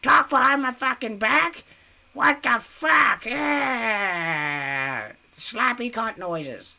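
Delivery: very high, loud mocking caricature; mock tantrum, stretching out into a long wail; hammed-up outraged whine, slowing as it goes; Michaela. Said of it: "that's my Michaela impression".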